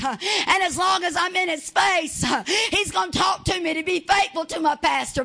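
fervent full-force sermon; preaching. Acted. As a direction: preach it to the whole church. Preaching continues, Woo, Hallelujah.

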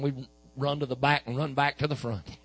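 normal talking voice

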